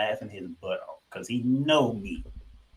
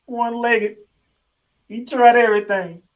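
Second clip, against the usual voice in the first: excited; 7 semitones higher, 9 dB louder than usual. Real, not acted. Hyped rant about Embiid.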